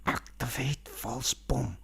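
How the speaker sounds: slow, breathy whisper; panicked whisper. Retold or imitated. Banana whispering to his suit in the dark, slow. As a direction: hiss it so Mango won't hear. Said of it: "he's going".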